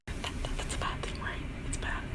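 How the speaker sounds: whispered fake dismay; mock whisper. Reader whispering mock dismay about the Wall. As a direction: hush it like a secret.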